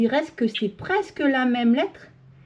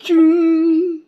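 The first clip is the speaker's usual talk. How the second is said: thump; 4 semitones higher, 8 dB louder than usual. Shown as a demonstration. Child shown how to stamp.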